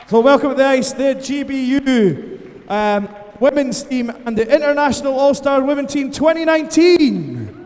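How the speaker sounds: loud, high team introduction with drawn-out endings; rally the crowd over the PA, stretching the big names; arena announcer. Real, not acted. Introduces teams over the arena microphone.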